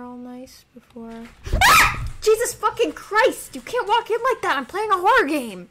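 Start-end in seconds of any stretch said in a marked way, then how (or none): 1.6-2.1 startled high shriek; jump-scare scream; sudden shriek of shock; real scream. Real, not acted. Startled by someone entering. 2.2-5.7 loud, higher angry outburst; real shocked shout; startled yell. Real, not acted. Yelling at intruder.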